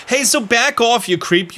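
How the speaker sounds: put-on Francesca snapping back in a Staten Island accent; a brash Staten Island girl telling him to back off. Girl tells a creep off, Staten Island accent. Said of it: "I'm gonna give her a Staten Island voice".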